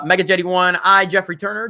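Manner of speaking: ordinary talk, nothing marked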